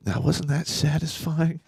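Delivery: slow, gloating voice of the evil side; the evil side of him gloating over the punch; evil inner voice. Retold or imitated. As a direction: savour it like a villain. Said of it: "evil pop's like".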